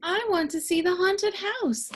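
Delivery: put-on high keen child voice; high little boy full of wishes; eager little brother. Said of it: "said Arthur".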